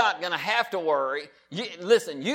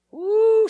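joyful whoop; 7 dB louder than usual. Real, not acted. Preacher's exuberant cry.

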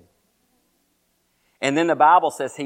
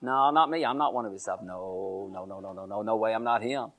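low, fading string of denials; acted, hushed disowning; muttered denial; 7 semitones lower, 9 dB quieter than usual. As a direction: back away from it under your breath. Peter backing away in denial.

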